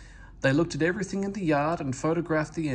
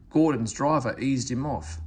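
plain reading voice

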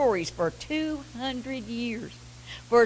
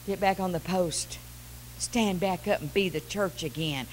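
soft urging; 3 semitones lower, 8 dB quieter than usual. Real, not acted. Preacher dropping low after shout.

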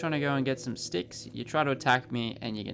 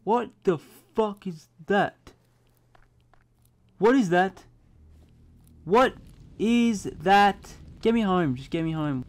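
high, loud freaked-out shouting; alarmed yelling; 7 semitones higher, 9 dB louder than usual. Real, not acted. Gamer panicking at a strange creature. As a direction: yell in fear at the thing. Unknown creature appears at dusk.

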